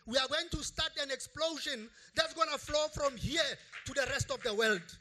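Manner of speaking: loud, rising preacher's proclamation; booming sermon climax; preaching at full force. Acted. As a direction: proclaim it to the whole hall. Preaching climax, Jesus quoted.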